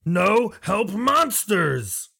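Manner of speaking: high, very slow, broken-speech lizard cry; lizardfolk protest. Acted. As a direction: plead NO like a frightened lizard. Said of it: "He'll start going".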